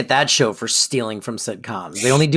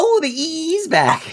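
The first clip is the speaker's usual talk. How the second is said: mock squeal; 11 semitones higher than usual. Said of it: "I went".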